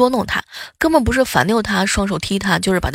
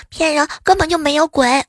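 put-on defiant child; 7 semitones higher than usual.

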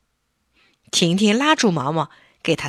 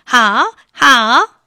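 higher, louder delighted puppet; shouting happily; 6 semitones higher, 8 dB louder than usual. Acted. Puppet thrilled about group photo, happy shout. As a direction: cheer it out with joy. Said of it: "嚷着".

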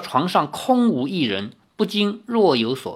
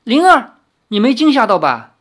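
higher, louder worried call; 9 semitones higher, 7 dB louder than usual. A narrator's reading. Li Xiaoyao calls out for Ling'er, anxious and loud. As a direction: shout her name in alarm. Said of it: "李逍遥叫道".

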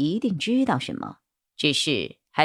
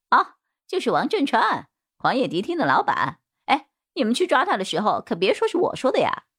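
higher, swooping character voice; cowed bald suspect; 7 semitones higher than usual. Acted. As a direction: spill it all in a pitched-up suspect voice. (光头嫌犯尖着嗓子老实交代)

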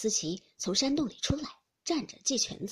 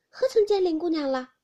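louder, higher flustered denial; startled maid; 7 semitones higher, 9 dB louder than usual. Acted. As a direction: blurt it out, caught off guard. Maid answering Baochai.

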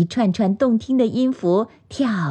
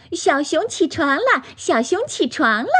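high, delighted storybook cry; happy calling; 8 semitones higher than usual. A narrator's reading. Magpie sees bear awake, high joyful call. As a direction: cheer the news in a high voice. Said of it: "高兴地喊起来".

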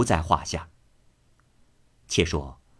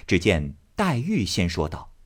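ordinary delivery, nothing marked

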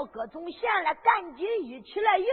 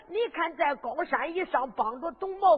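plain reading voice

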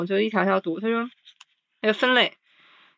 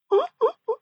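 high squeaky laugh. Real, not acted. Laugh at own realization.

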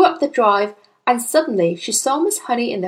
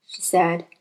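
plain reading voice

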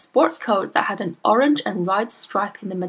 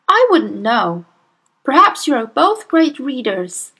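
pointed sarcasm; 6 semitones higher, 5 dB louder than usual. A narrator's reading.

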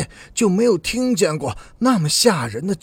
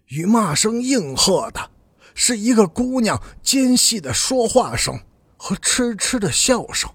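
usual speaking voice

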